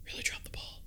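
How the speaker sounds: breathy whispered aside. Real, not acted. Fan whispering a verdict on Bail, whispered. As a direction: hush it to yourself.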